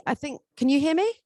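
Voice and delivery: usual speaking voice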